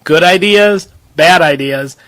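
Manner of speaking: ordinary delivery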